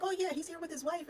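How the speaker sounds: lightly voiced chirpy mom; high mom voice. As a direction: mimic the mother's casual sing-song question. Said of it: "She was just like".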